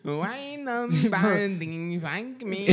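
high, very slow mock wail; Chinese incel voice. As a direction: stretch every word, high put-on accent. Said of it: "the Chinese incel".

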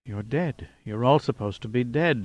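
incredulous mutter. A narrator's reading. Shocked prisoner seeing his enemies alive, soft mutter. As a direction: mumble it as if you can't believe it. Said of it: "he muttered incredulously".

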